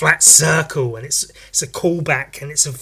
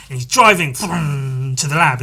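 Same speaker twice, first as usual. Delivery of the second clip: ordinary talk, nothing marked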